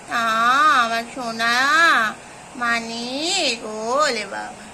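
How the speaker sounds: tender pet talk to a kitten. Real, not acted. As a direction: coo over the little one.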